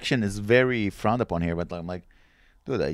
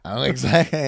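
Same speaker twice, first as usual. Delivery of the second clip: ordinary talk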